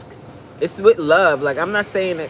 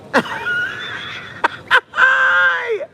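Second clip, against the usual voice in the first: shrieking laugh. Real, not acted. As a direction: burst out in a long, high shriek of laughter. Laughs at own joke.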